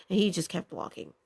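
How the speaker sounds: ordinary talk, nothing marked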